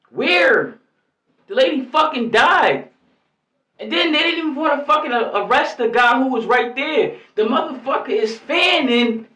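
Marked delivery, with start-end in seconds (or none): 0.1-5.3 higher, louder furious outburst; furious at the police; real outrage. Real, not acted. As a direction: let the anger boil over. Outraged rant peak.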